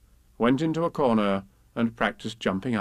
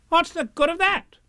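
high, louder impatient retort; scornful Rabbit; 4 dB louder than usual. Acted. Rabbit scoffs at Pooh, high impatient voice. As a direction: dismiss the idea.

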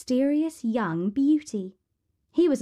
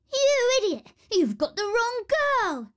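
very high, screechy protest; 13 semitones higher than usual. A narrator's reading. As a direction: squeal in outrage like spoilt sisters. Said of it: "protested the horrid sisters".

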